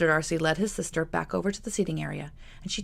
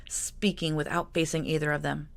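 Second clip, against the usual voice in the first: ordinary delivery, nothing marked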